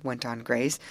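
ordinary delivery, nothing marked

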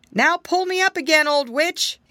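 high, loud call upward. Acted. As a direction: shout up through the tree. Soldier calls up the tree.

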